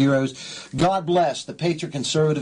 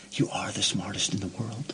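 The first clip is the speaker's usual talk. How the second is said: much softer, low and deliberate; quiet emphasis; 8 semitones lower, 9 dB quieter than usual. Shown as a demonstration. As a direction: drop to a hushed, earnest delivery. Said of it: "Let me say it one more time".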